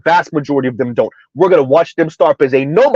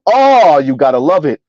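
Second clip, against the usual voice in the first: ordinary delivery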